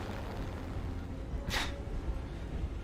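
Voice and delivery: a breathy, airy little laugh; real soft laugh, almost silent. Real, not acted. Gamer laughs softly at his own forgetfulness. Laughs mid-thought while gaming.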